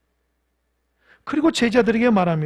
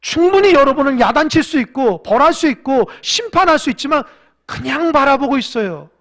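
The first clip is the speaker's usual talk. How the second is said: preaching at full force; 6 semitones higher, 10 dB louder than usual. Acted. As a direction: preach it with a catch of tears in the voice. Sermon climax. (열정적으로 외치는 설교)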